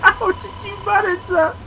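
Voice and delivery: laughing in amazement at a friend's mistake; laughing disbelief. Real, not acted. Friend reveals absurd mispronunciation.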